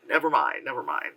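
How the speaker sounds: deflated retreat; mock deflated. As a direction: back off, let it sink into a low mumble. Backing off after the wail.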